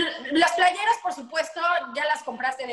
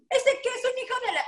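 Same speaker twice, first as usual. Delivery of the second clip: mock insult with a laugh; 10 semitones higher, faster than usual. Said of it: "nadie te está diciendo".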